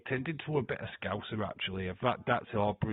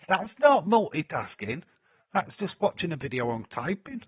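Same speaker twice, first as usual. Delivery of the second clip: scoffing; 6 semitones higher, 7 dB louder than usual. Real, not acted. Scoffing at a chatter.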